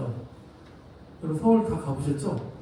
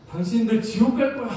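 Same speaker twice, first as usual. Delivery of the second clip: raised-pitch, mildly scolding imitation; mock combative; 5 semitones higher than usual. Street preacher threatening subway passengers with hell, mock combative. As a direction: imitate someone picking a fight. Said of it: "이 억양은 싸우듯이 말씀하시더라고요".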